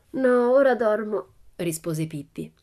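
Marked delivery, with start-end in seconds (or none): 0.1-1.3 put-on slow, high and louder girl's refusal. A narrator's reading. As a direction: dismiss them, wanting to sleep. Pippi refuses from bed. (rifiuto da bambina, lento, acuto e più forte)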